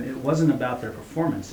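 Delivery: plain reading voice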